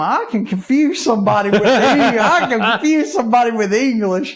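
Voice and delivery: 边笑边说，语气自夸, loud and amused; laughing brag. Real, not acted. Joking brag about Alabama.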